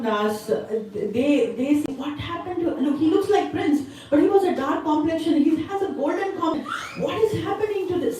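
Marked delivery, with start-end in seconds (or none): 2.0-8.2 high-pitched, bewildered chatter; acted amazement, voice lifted high; astonished villagers. Villagers to each other about the changed boy, astonished.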